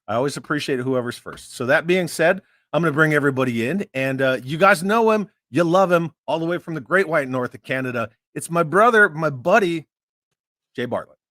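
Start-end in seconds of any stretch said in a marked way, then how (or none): 6.5-11.2 loud hype intro of the guest; announcer intro. Acted. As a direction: present him like a ring announcer.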